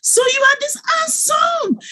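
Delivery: high, amazed cry of joy; overjoyed squeal at her son's face. Retold or imitated. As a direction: gasp it out in delighted disbelief. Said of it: "I'm like".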